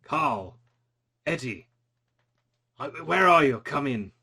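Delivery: lightly voiced guard calling on a walkie-talkie; a hint of a henchman calling his men; guard calling his crew over a radio. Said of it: "and he says".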